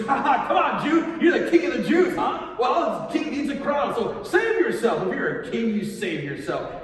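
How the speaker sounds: loud, high jeering sergeant; mocking laughter. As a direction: taunt the prisoner with a put-on "ha ha".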